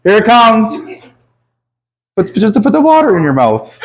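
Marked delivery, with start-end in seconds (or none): none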